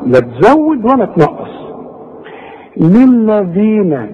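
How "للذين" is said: للذين with the long ii held two counts, slow; natural madd. Shown as a demonstration. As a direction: draw out the ya in للذين. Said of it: "واخد بالك". (مد الياء في للذين حركتين)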